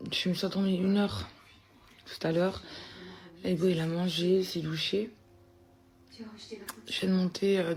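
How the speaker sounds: hushed late-night talk. Real, not acted. Late night, speaking low.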